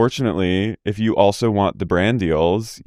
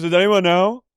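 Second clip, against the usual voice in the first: high bewildered question; mock lost voice; 9 semitones higher than usual. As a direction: act dazed and lost. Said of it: "She goes".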